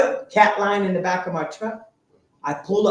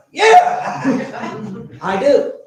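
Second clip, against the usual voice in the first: laughing; 4 semitones higher, 8 dB louder, much slower than usual. Retold or imitated. Laughing yes to crack offer.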